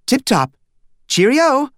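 chirpy high British-style "Cheerio!"; mother trying out British slang on the phone; mock British. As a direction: sign off like a cheerful Brit. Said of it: "never heard his mother use those words".

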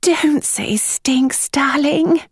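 high, slow, hushed correction; weary murmur. A narrator's reading. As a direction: chide gently from the sofa. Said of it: "his mother said from the depths of the sofa".

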